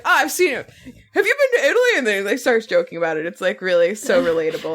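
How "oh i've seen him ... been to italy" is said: mock awkward excitement. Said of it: "He's like".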